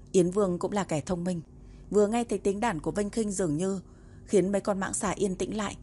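ordinary delivery, nothing marked

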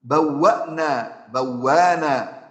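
slow بوانا, the hamza turned into a long u; hamza eased to long u. Shown as a demonstration. Teacher slowly models the hamza change. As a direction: stretch بوأنا so it sounds بوانا. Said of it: "همزة ساكنة قبلها مضموم".

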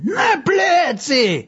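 doctor roaring the command; sudden roar. A narrator's reading. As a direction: bellow it at the patient. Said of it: "ryknął nagle".

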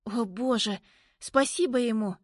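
excited thanks, voice lifting; relieved. A narrator's reading. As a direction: burst out with relief. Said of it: "В голосе Лизы столько облегчения".

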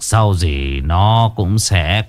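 put-on low, slow, sly old abbot voice; sinister old abbot. A narrator's reading. Demon abbot dismissing the fleeing girl, low sinister voice. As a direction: purr it with a creepy smile. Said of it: "nụ cười dị hợm".

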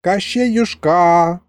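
slow, drawn-out fond call; drawn-out coaxing. A narrator's reading. Grandpa coaxes Koschei by name, slow and drawn out. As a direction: stretch his name sweetly. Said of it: "ласково протянул Михалыч".

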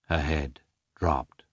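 usual speaking voice

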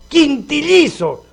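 high-pitched crowing boast, first word drawn out; boastful exclamation. Acted. Argentine father boasting to the doctor about newborns, high exclaiming. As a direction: crow it high like a braggart dad.

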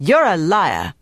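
put-on a young woman turning on a liar; sudden accusation. A narrator's reading. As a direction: snap it out as an accusation. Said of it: "Marie's tone had changed".

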